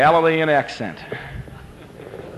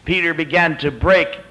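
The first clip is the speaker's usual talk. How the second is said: normal talking voice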